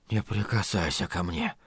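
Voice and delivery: low, hushed recoiling protest; recoiling exclamation. A narrator's reading. Lloyd backing away from Flagg, exclaiming under his breath. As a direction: keep him away with a very soft plea. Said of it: "воскликнул он".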